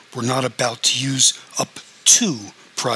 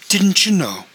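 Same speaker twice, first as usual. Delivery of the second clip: a higher, louder note of mock surprise; feigned surprise; 6 semitones higher, 4 dB louder than usual. Investigator feigning surprise to the injured patient, higher and louder. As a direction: play it as if the news is a shock to him. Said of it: "looked as if he were genuinely surprised".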